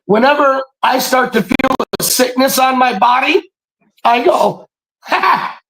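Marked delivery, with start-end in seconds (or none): 5.0-5.6 rebuking shout. Retold or imitated. Rebuking a sickness he feels coming on, defiant shout. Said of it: "That's how I talk".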